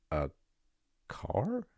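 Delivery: stunned high whisper, breath only; a breathless, incredulous whisper; whispered disbelief. A narrator's reading. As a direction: gasp the word out in disbelief. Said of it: "stares at him once more in disbelief".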